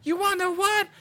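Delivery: very high, hammed-up screamed taunt; imitated screaming. Retelling a girl taunting monsters from the porch, hammed-up screaming. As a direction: build a mock scream to a loud high peak. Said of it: "starts screaming".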